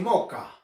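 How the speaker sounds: "moca" for "mosca", s left out; dropped s. Shown as a demonstration. Teacher mimicking speakers who drop the s. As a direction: say "moca", skipping the s. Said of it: "Algunos dicen".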